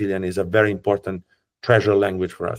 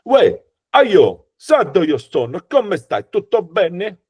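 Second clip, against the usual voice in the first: Sardinian accent, pitched much higher, consonants doubled hard; 7 semitones higher, 5 dB louder, slower than usual. Shown as a demonstration. Guide demonstrating the Sardinian accent to viewers, punchy doubled consonants. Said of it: "so they sound like this".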